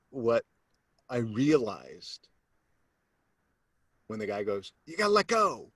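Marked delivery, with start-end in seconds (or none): 4.9-5.7 high, urgent call; mock instructor yell. Speaker quotes instructor on the wing, mock instructor yell. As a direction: call it out as over the wind. Repeats instructor's shouted order.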